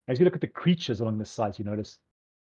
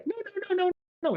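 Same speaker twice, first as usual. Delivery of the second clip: very high, frightened no's, fleeing; a scared little creature squealing as it runs; panicked high cries. Acted. Child running from the troll, squealing in panic. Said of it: "You hear him go".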